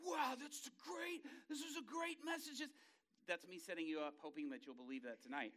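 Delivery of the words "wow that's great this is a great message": high, slow, wide-eyed gushing; gushing listener. Retold or imitated. Congregation member gushing over the guest preacher, high and awed. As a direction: gush like a dazzled churchgoer. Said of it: "you're like".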